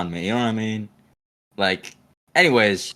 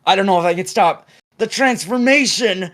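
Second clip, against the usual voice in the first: hammed-up high, loud werewolf alarm; mock panic; 8 semitones higher, 5 dB louder than usual. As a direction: build to a melodramatic cry. Dramatises own werewolf text reply.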